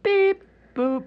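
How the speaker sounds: high beep, then boop; electronic game beeps. Pretend. Guest imitating the Simon game, electronic beeps. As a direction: imitate an electronic game's tones. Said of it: "It sounds like the Simon game".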